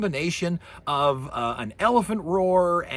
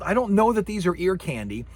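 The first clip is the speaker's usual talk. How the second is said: ordinary delivery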